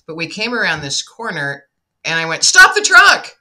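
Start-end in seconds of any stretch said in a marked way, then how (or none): 2.4-3.3 loud, high-pitched yell; retold urgent yell. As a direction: shout it suddenly, acting out the moment. Retold urgent cry to brother.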